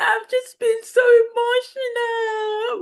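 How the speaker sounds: high, slow fake-sobbing imitation; mock crying. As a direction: parody her blubbering. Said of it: "she's like".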